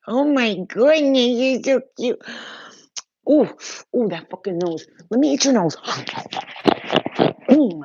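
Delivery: high and loud squealing over the dog. Real, not acted. Gushing over her dog's cute nose, loud high squeal. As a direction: squeal with delight at the cute puppy.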